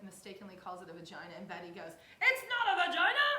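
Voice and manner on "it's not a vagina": high, very loud mock outburst; imitated yelling. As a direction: yell it as an impression of her. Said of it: "that's how she talks. She kinda, like, yells".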